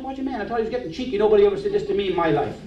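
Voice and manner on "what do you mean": soft, higher-pitched question; muted puzzled retort. Retold or imitated. Man thinks the pastor is getting cheeky, muted retort. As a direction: ask it under your breath, taken aback.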